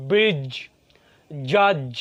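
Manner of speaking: "ব্রিজ" and "জাজ" with a hard-pressed final J; strong accented J. Shown as a demonstration. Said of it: "strong অ্যাকসেন্টেড pressure দিয়ে". ("ব্রিজ", "জাজ"-এ জোর চাপ দিয়ে জ)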